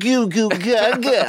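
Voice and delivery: put-on high, drawn-out baby babble. Co-host trying out a baby voice for the name, high babbling. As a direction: coo it slowly like a baby. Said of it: "The baby voice".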